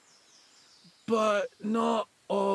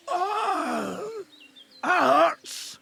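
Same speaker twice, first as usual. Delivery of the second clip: pained cry; 9 semitones higher, 3 dB louder, much slower than usual. Acted. Injured man cries out.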